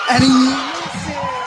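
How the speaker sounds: slow, high, loud shout on the miss; excited shout. Real, not acted. Color man calls missed free throw.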